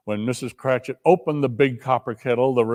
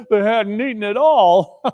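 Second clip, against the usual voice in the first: laughing through the words; 7 semitones higher, 5 dB louder than usual. A narrator's reading. Missus Cratchit laughing over the bare bone. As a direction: say it with a chuckle. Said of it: "Missus Cratchit laughed and said".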